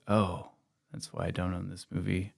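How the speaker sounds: low, soft, deflated realisation; acted letdown. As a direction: drop your voice as it sinks in. Acts out viewer's sudden letdown.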